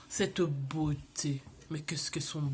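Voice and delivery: breathless admiring whisper; awed whisper. Real, not acted. Admiring her plant to viewers, hushed awed whisper. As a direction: whisper it like you're gazing at something beautiful.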